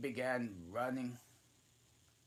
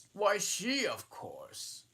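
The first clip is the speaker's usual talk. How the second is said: dodo's answer; 11 semitones higher, 6 dB louder, slower than usual. A narrator's reading. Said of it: "said the dodo".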